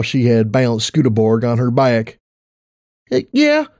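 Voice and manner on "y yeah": put-on little girl voice. Child answers her mother.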